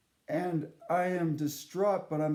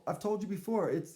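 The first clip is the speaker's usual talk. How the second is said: ordinary talk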